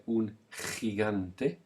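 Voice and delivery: un gigante very slowly, gi as raspy khi; teacher drills gigante slowly for learners; breathy ch sound. Shown as a demonstration. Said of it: "expel lots of air".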